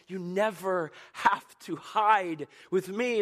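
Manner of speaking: high, loud, slow proclamation; booming sermon climax; preaching at full force. Acted. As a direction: proclaim it like a trumpet.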